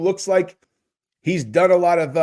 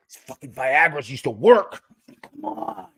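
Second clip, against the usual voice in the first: frustrated. Acted. Man whose pills used to work, acting frustrated. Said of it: "he's all frustrated".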